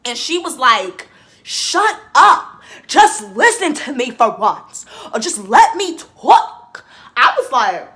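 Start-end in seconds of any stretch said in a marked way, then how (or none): 1.4-6.8 mock yell. Imitating Giannia yelling at Damien, loud mock snap. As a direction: imitate her yelling him down.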